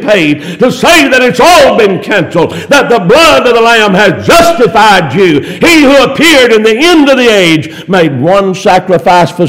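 high, driving sermon cadence; full-force preaching. Real, not acted. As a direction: proclaim it to the congregation in rolling phrases.

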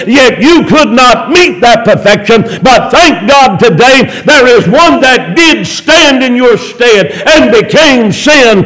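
high, driving sermon cadence; full-force preaching. Real, not acted. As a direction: proclaim it to the congregation in rolling phrases.

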